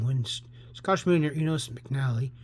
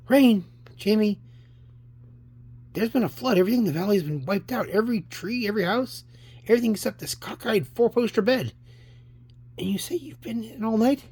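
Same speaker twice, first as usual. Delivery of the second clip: raised, emphatic dad voice; incredulous father; 7 semitones higher, 4 dB louder than usual. Acted. Father telling his son about the flood, alarmed and incredulous. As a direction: tell it urgently, in disbelief.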